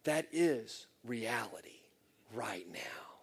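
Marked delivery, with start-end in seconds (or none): 2.3-3.1 whispered emphasis. Real, not acted. Sermon point hushed.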